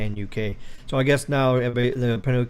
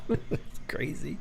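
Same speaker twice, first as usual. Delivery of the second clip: whispered aside; 3 semitones higher, 10 dB quieter, slower than usual. Real, not acted. Aside after mocking British slang.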